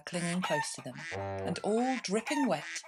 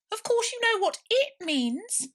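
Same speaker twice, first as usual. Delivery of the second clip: very high, loud and slow, condescending; cross mouse; 5 dB louder than usual. A narrator's reading. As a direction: spell it out with tiny irritation. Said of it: "rather crossly".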